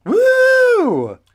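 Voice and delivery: high whooping cheer; excited cheer. Real, not acted. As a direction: whoop with glee. Hosts cheer.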